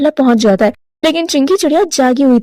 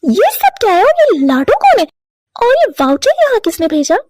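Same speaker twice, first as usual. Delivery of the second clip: higher, demanding questions; suspicious wife; 5 semitones higher than usual. Acted. Chinki demanding answers from her husband, higher and sharp. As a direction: confront him hard.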